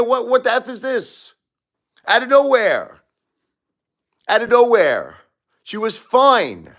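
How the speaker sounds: very high, strained wailing rant; pissed-off anguish. Real, not acted. Widower crying out to his stream that his wife was fine, strained and high. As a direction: wail it out, voice cracking high. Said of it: "I'm pissed off".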